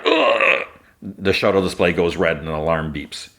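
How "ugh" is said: very high strained groan; imitated pained groan. Trooper attacked in the shuttle, pained groan. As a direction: act out a trooper being hit.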